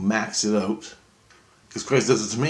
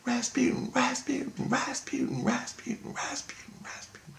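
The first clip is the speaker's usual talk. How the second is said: fading whisper; 7 dB quieter than usual. Retold or imitated. Inner voice chanting name, fading.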